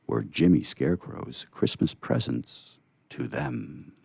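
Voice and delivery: plain reading voice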